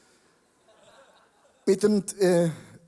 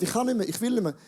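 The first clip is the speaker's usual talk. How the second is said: ordinary talk, nothing marked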